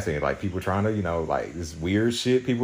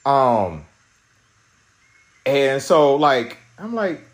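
ordinary delivery

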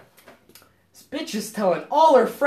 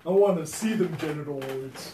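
mock ex voice; 4 semitones lower, 5 dB quieter than usual. Friend mocks the ex.

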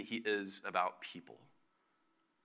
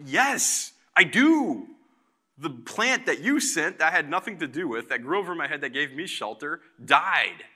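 higher, louder, imitating a sulky protest; indignant Jonah; 4 semitones higher, 6 dB louder than usual. Jonah whining to God about his dead plant, mock-indignant and higher. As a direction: play Jonah as a petulant, put-upon complainer. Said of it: "And Jonah's like".

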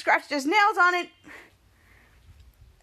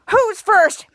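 loud, higher-pitched acted call; menacing demand; 5 semitones higher, 7 dB louder than usual. Ray calls for the first neck, menacing demand. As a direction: punch it out loudly to the group.

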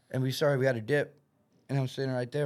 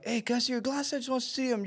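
put-on girl voice; 8 semitones higher, 5 dB quieter, faster than usual. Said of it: "one of them was like".

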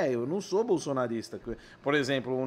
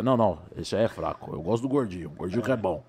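imitação de rapper em voz bem grave; [name]; 10 semitones lower than usual. Acted. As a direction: responder lá embaixo, no grave. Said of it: "que imita o [name]". (voz grave e seca)

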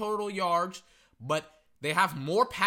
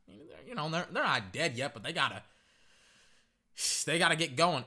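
usual speaking voice

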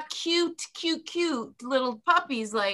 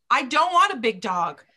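ordinary talk, nothing marked

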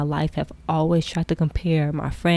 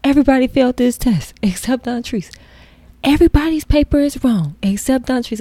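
louder, higher mock teacher scold; hammed-up teacher complaint; mock teacher scolding class; 6 semitones higher, 6 dB louder than usual.

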